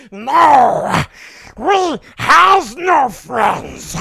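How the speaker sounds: loud Gollum shout, slow; Gollum snapping. Acted. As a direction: snap back as angry Gollum. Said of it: "that's my Golem impression".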